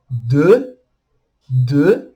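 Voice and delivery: "de" as deux, E through rounded lips; French pouted vowel. Shown as a demonstration. Teacher showing the word for two, pouted pronunciation demo. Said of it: "fala do famoso biquinho".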